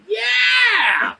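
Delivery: high, drawn-out gleeful cry; reenacted kid's triumph; triumphant cheer. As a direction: crow over your new creation. Boasting over carved gun.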